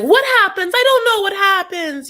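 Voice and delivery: very high, loud imitation; mock bewildered wife. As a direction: wail it in exaggerated confusion. Imitates upset wife asking what happens.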